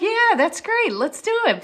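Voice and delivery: high, loud, enthusiastic yes; eager agreement. Retold or imitated. Speaker accepts the coffee invitation, excited. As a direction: jump at the invitation.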